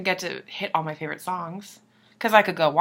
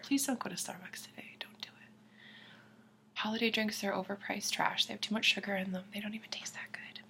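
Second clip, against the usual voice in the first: conspiratorial whisper, much softer; mock secret whisper; 2 semitones higher, 13 dB quieter than usual. Barista secretly warning viewers off Starbucks in a whisper. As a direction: whisper it so the boss can't hear.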